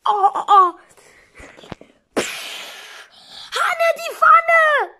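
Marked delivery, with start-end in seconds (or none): 3.5-5.0 high, loud, slow warning cry; alarmed shout. Acted. Warning flatmate about the pan, high alarmed shout. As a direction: call out in alarm.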